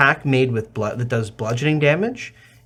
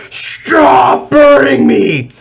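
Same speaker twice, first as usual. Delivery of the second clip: loud, high, slow monster bellow; burning troll; 10 semitones higher, 10 dB louder, much slower than usual. Acted. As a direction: roar in agony like a troll on fire. Game master voices the burning troll.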